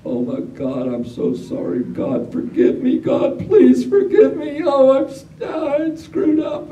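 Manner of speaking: hammed-up wail of a guilty old man; mock moaning. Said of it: "moaning and screaming".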